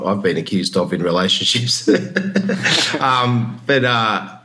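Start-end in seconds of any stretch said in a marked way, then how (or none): 1.9-2.9 self-conscious laugh. Real, not acted. Laughs at own confession.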